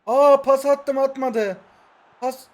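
loud, high cry of dismay; dismayed protest. Real, not acted. Player cries out at a pass gone wrong, dismayed.

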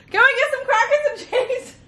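very high mock yell, laughing; squealed, hammed-up call for service; retold yell. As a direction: imitate someone calling across a plane, high-pitched. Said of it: "She yells to the other side of the plane".

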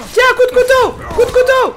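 loud high panicked cries; panicked yelling. Real, not acted. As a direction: yell in panic as you get stabbed. Stabbed in horror game.

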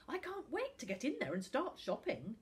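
high-pitched excited shopper; eager. A narrator's reading. Eager shopper overheard in crowd.

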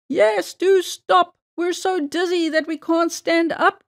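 high-pitched cry from a dizzy fox; pleading cry. A narrator's reading. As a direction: cry it out high, drawing out the first plea. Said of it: "cried the other fox".

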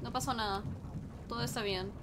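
ordinary talk

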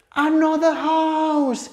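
very high, slow, stretched wonder; hammed-up storyteller awe; mounting amazement. Shown as a demonstration. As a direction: build the suspense for toddlers. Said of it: "se va como poniendo súper interesante".

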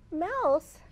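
plain reading voice

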